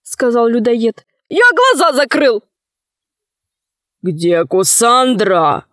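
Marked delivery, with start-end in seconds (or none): 1.3-2.4 high, panicky protest; cornered fibber's high cry; frantic denial. A narrator's reading. Cannibal frantically denies it. 4.0-5.7 slow, steady demand; deliberate, weighty question; stern hero. A narrator's reading. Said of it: "спросил Сила".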